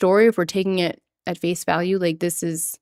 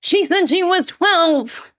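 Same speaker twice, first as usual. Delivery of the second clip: high, slow put-on voice; mimicking the video; 8 semitones higher, slower than usual. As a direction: parrot the line in his voice. Imitating the viral video quote.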